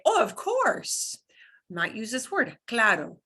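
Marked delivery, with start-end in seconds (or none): 0.0-1.2 slow, high "oh, of course". Retold or imitated. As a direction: say it like it's obvious. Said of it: "if they want to say".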